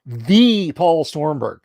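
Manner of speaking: "the" drawn out slow and loud; stress on THE. Acted. Hyping up the famous guest to viewers, slow stressed "the". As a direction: lean hard on "the", slow and proud.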